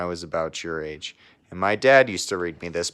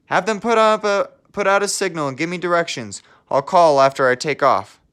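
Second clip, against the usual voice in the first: much higher, louder, brisk reply; eager helicopter pilot; 9 semitones higher, 7 dB louder than usual. Acted. Pilot replying to control over the radio, higher, louder, eager. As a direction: answer control quickly, ready to fly.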